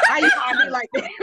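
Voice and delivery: high, laughing through the words. Real, not acted. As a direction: laugh while saying it. Repeats the line laughing.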